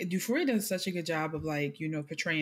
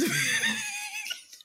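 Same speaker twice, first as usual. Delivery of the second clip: very high cackling laugh; laughing shriek. Real, not acted. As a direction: crack up at your own joke. Laughing at own mom impression.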